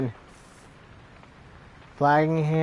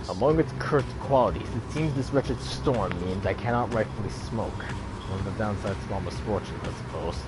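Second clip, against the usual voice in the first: lightly voiced, lower, resigned grumbler; a hint of dry complaint, sinking low; Wolfert; 7 semitones lower, 4 dB quieter than usual. Wolfert lamenting that he cannot smoke, lightly voiced low grumble.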